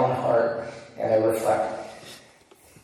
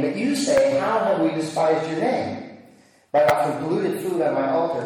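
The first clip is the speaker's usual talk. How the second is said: raised, earnest reading of scripture; 4 semitones higher than usual. Acted. Preacher reads Malachi's rebuke to the priests, earnest proclamation. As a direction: proclaim the Lord's words to the priests.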